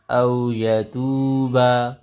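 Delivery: slow, drawn-out chanted phrase; Quranic recitation. Shown as a demonstration. Teacher chanting the spelled word for students, slowly. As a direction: intone it long and melodic. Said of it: "Lakha مین الامر شیء".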